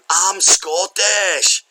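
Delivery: high, drawn-out mock-Scottish cry. Shown as a demonstration. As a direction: stretch it out in a hammed-up Scottish accent. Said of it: "I can't say anything in a Scottish accent other than".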